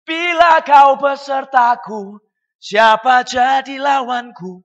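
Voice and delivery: slow, loud proclaiming of the psalm; booming quote. Acted. Preacher booming David's words to the congregation, slow and loud. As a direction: call it out slowly like David's battle cry. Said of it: "Makanya Daud berkata".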